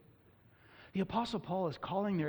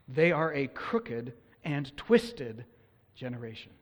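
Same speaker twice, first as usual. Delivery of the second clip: slow, weighty reading of the verse with long pauses; scripture reading; slower than usual. Acted. Preacher slowly reading the condemnation of the old generation. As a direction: read it out slowly, like holy text. Reads Deuteronomy verse aloud.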